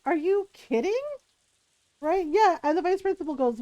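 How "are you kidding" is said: higher, put-on voice of a scandalized grandmother; incredulous grandmother. Grandmother side-eyeing the bully, imitated in higher disbelieving voice. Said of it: "she's like".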